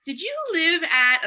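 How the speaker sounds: a fan confronting her after a show; mock stalker fan. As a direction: play an eager fan at a show. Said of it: "they're like".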